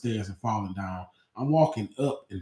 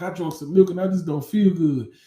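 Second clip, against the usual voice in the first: higher and louder, pitiful; mock sick whine; 6 semitones higher, 7 dB louder than usual. Student lying to the school nurse, higher pitiful whine. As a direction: play the poor sick kid.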